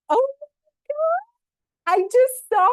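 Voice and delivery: very high, loud and slow, stretched out in awe; barely holding in the joy; overjoyed. Real, not acted. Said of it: "I'm trying to contain myself".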